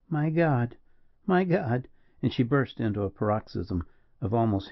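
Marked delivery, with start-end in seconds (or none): 0.1-1.9 rising higher in anguish; anguished cry. Acted. Woman hearing her friend is dying, anguished cry. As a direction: cry out as the news sinks in.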